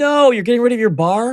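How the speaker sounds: high, loud incredulous no; can't believe he's losing the bar; shocked disbelief. Real, not acted. To cohost ditching his bar, shocked disbelief.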